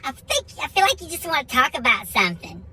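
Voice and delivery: high, coaxing wife voice; concerned wife. Retold or imitated. Wife coaxing husband to open up, high and concerned. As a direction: imitate a wife probing his feelings. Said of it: "she comes back outside".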